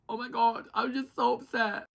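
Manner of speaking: high mock-distressed imitation; put-on upset. Contestant told who picked her, badly faked upset. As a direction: overplay being hurt, pitched high. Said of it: "I think [name]'s putting it on".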